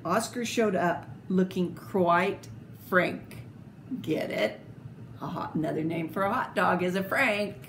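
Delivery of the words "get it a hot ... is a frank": ordinary delivery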